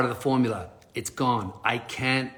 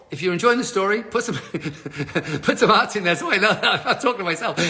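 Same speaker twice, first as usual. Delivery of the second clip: laughing; 5 semitones higher, 3 dB louder, faster than usual. Real, not acted. Laughing aside to viewers.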